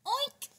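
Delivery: high squeaky oink; piglet oink. Acted. Mom oinking as the piglet for her son. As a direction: squeal like a little pig.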